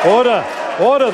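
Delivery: stern shout of order. Real, not acted. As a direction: bark for order in the chamber. Speaker calling House to order.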